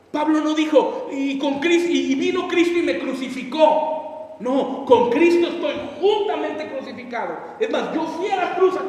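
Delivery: loud, slow, high preaching; fiery preaching. Acted. Pastor preaching to the congregation, fiery. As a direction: preach it with full force.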